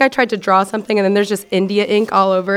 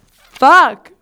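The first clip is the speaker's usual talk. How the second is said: loud, higher curse; cringing shout; 6 semitones higher, 9 dB louder than usual. Real, not acted. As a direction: blurt it out in embarrassment. Cringing at own lyrics.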